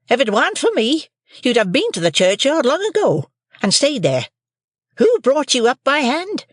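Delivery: shrill high shrewish scold; scolding sister. A narrator's reading. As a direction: Mrs Joe scolds young Pip, high and shrill.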